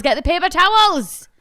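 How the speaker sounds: put-on frantic schoolgirl shouting at the boy next to her. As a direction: yell for the towels in a panic. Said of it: "frantically and loudly asking".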